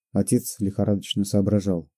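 plain reading voice

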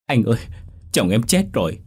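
wife sobbing out news of her husband's death. A narrator's reading. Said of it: "khóc nức nở".

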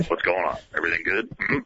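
low, muttering manager check-in; Jim Leyland impression. Leyland quizzing the host in his office, low grumble. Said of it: "a little more of that".

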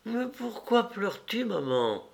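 put-on high, soft child's question, dropping on the last word; wide-awake child. A narrator's reading. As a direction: ask like a puzzled little boy. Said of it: "demanda-t-il tout à fait réveillé". (voix aiguë et douce d'enfant qui s'interroge)

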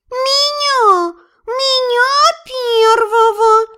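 high, drawn-out cat wail; howling cat. A narrator's reading. Cat in a bag demands to go first, howling. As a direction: yowl it slowly on a high pitch. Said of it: "взвыл из сумки Филя".

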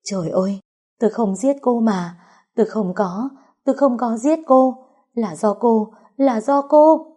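high, rising frantic denial; crying wail. A narrator's reading. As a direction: wail the denial, building to a shriek. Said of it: "tiếng khóc kêu gào của Vũ Huệ".

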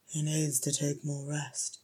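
soft, gruff-voiced healer. A narrator's reading. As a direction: grumble it softly, a little gruff. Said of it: "said a gruff voice".

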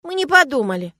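high, loud contrite squirrel; penitent. A narrator's reading. Squirrel admits they had not thought, penitent. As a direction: own up with squeaky remorse. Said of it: "покаянно произнёс белк".

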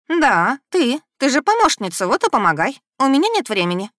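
bossy demand. A narrator's reading. Elza bossing her around.

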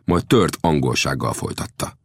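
plain reading voice

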